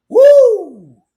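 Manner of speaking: sudden very high, loud whoop; excited woo. Real, not acted. Streamer amazed by a viewer's savings comment, loud whoop. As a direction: let out a real whoop of amazement.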